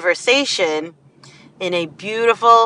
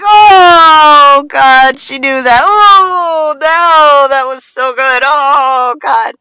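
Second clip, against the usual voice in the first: high, loud amazed gasps; mock-overwhelmed fan reaction; awed audience; 7 semitones higher, 8 dB louder, much slower than usual. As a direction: audience blown away by the sales pitch. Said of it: "you're like".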